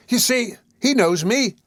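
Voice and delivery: high, slow, triumphant appeal to the crowd; Nancy appeals to the bystanders, high and slow; appealing cry. A narrator's reading. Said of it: "cried Nancy, appealing to the bystanders".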